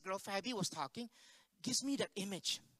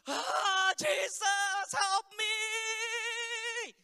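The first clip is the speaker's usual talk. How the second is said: imitated scream for help, very high and loud; shaking desperate cry; 13 semitones higher, 7 dB louder, much slower than usual. Girl finally calls on Jesus, drawn-out desperate scream. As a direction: wail it out, holding the last word long. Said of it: "like, shaking, and she was like".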